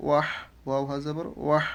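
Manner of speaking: "ওয়াহ" ending in a strong breath from the throat; "ওয়াহ" with a long Arabic throat h; throaty big ha. Shown as a demonstration. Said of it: "গলার মধ্যে এই উচ্চারণটা নিয়ে".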